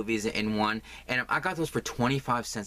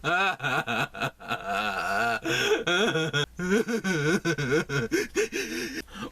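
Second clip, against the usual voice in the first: high, long laugh melting into a mock wail; hammed-up laugh-cry; 8 semitones higher than usual. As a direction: cackle that turns into fake sobbing. Laugh turning to fake sobs.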